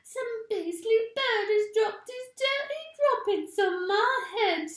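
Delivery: put-on high, loud, disgusted old wife's voice; a cranky old woman, high and loud; Missus Twit. A narrator's reading. Missus Twit complains to her husband about the bird, high loud disgust. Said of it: "cried Missus Twit".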